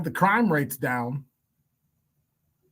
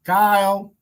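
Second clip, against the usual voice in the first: raised, higher call for someone absent; 7 semitones higher, 6 dB louder than usual. Real, not acted. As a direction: call out loudly to summon a colleague. Calling for someone who is absent.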